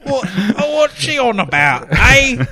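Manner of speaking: put-on high, loud Norwegian accent parody; a clumsy Norwegian accent, pushed up high; bad Norwegian accent. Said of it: "I do not know how to do a Norwegian accent".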